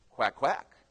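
low, soft duck quacks; hushed, half-hearted quacking; duck congregation quacking. Acted. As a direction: quack quietly, a subdued congregation response. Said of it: "the duck congregation would go".

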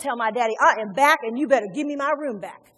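loud, brisk mock entitlement; demanding, not humble. Preacher voicing the son he wasn't, demanding and unhumbled. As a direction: announce it like you are owed it. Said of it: "That's not humbling themselves".

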